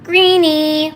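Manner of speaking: high, drawn-out treat offer; talking to a dog. Real, not acted. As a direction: sing the treat word to a pet.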